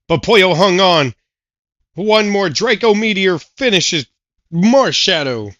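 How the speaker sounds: play-by-play announcer. Acted. Announcer calling the battle.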